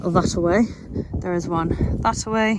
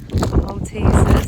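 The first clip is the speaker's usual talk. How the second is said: announcing; 7 dB louder, much slower than usual. Real, not acted. Announces snack slowly, loudly.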